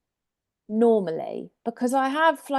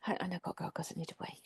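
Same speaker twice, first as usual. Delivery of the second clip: hushed, almost whispered aside; barely audible little confession; quiet mumble; 14 dB quieter, faster than usual. Retold or imitated. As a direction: mutter it under your breath, embarrassed. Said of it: "she does say it quietly".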